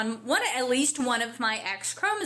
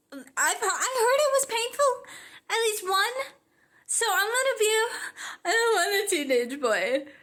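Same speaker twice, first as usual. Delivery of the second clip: mock distress, high; 9 semitones higher than usual. Real, not acted. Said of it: "voice cracking".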